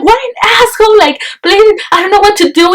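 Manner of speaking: high, outraged whine; indignant and pleading, pitched well up; mock outrage. Outraged at him laughing.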